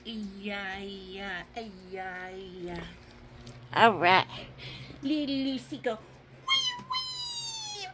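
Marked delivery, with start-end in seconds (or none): 0.1-2.8 low, very soft comforting murmur; soothing. Pretend. Soothing crying toys. 6.5-7.9 tiny high, soft wailing; pretend toy crying. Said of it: "Don't cry".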